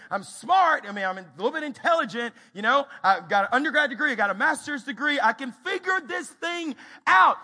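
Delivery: loud, high boast building to shout; defiant bravado. Acted. Man refusing to admit ignorance, blustering.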